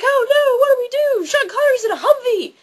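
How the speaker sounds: high, loud hammed-up alarm; mock panic. Everyone reacting to Connery in a Humvee, mock panicked yelling. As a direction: cry out like a panicking crowd. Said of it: "And so everyone's like".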